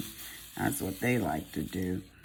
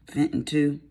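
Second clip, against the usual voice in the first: higher, louder country grandma voice; Mississippi grandmother; 5 semitones higher, 6 dB louder than usual. Retold or imitated. Vlogger quoting her grandmother's saying, imitated country grandma. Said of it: "speaking of my grandma, she would always say".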